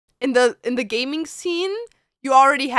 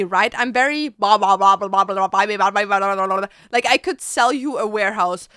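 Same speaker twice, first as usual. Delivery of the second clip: ordinary talk, nothing marked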